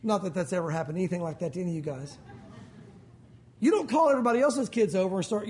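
ordinary talk, nothing marked

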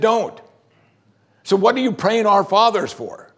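high-pitched exasperation; indignant. Real, not acted. Indignant at vain repetition of prayers.

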